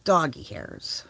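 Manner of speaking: whispered in disgust. Real, not acted. Whispering to viewers about dog hair in the coffee, disgusted. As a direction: breathe it out, fed up with the dog hair.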